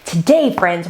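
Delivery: ordinary delivery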